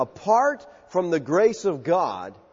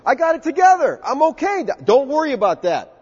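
high, louder, cocky reassurance; mock overconfidence; 8 semitones higher, 4 dB louder than usual. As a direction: brush off all worry smugly. Mocking the overconfident believer.

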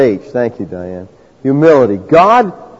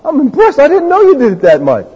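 sarcastic wide-eyed wow, pitched up; mock impressed God; 4 semitones higher than usual. God mocking the boasting Pharisee, sarcastic awe. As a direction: fake amazement, teasing. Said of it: "God must say".

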